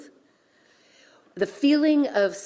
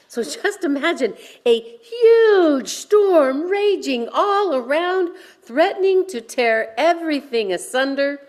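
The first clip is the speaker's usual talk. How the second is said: dramatic storm image; 7 semitones higher, 5 dB louder than usual. Acted.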